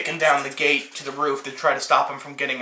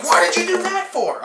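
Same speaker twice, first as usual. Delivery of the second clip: much higher, squeaky outburst of disbelief; mock squeaky voice; 13 semitones higher than usual. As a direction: jump way up into a squeak, baffled.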